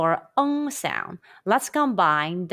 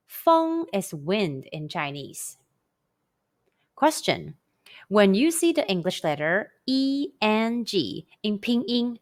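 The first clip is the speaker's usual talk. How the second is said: ordinary delivery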